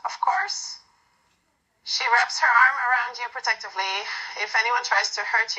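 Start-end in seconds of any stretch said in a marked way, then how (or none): 0.1-0.8 whispered, dead serious reply; serious hush. A narrator's reading. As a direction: breathe it out with firm certainty. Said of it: "her tone leaving no room for doubt".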